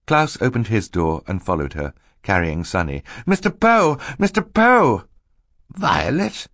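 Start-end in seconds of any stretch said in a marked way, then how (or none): 3.2-5.0 put-on high, loud child's call. Klaus's party hails Mister Poe, calling out. 5.7-6.5 high, rising query; surprised question. Acted. Mister Poe spots Violet at the crash, surprised.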